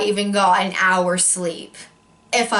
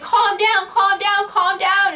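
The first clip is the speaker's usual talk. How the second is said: much higher, mock soothing mom voice; imitating mom soothing; 10 semitones higher than usual. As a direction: repeat it like a fussing mother calming her kid. Mom soothing panicking daughter.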